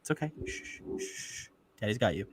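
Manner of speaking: put-on soft hushing, like calming a baby; soothing a child. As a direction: hush him gently like a dad.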